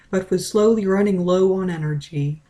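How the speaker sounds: ordinary delivery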